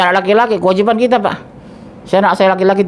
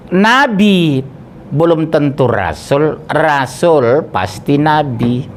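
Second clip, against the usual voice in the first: very slow, word by word for memorising; drilled slowly like a rule to learn; slow, emphatic rule repeated; much slower than usual. Shown as a demonstration. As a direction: spell it out slowly so they remember. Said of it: "teacher repeats rule for memorising".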